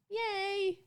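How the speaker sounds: ordinary talk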